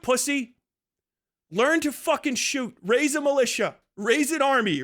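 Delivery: normal talking voice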